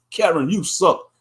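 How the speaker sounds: usual speaking voice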